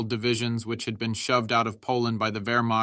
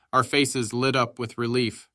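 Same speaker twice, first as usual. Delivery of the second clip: ordinary delivery, nothing marked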